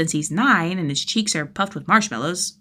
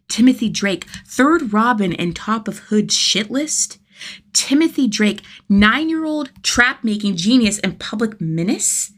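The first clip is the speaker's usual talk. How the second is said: louder and higher, slow, each name heavier; dawning alarm, building up; building intensity; 5 semitones higher, 6 dB louder, slower than usual. A narrator's reading. Rising realization, building.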